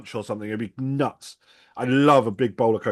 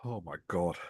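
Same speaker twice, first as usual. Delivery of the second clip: strained hushed groan; burning mouth wince; pained gasp; 8 semitones lower, 14 dB quieter than usual. Real, not acted. Eating ghost pepper sauce.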